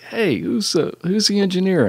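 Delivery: put-on high-pitched rough go at a Jersey accent; attempted Jersey accent. Big studio musician asking after the engineer, attempted Jersey accent. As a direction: call out in a loosely imitated Jersey accent. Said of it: "I can't do a Jersey accent".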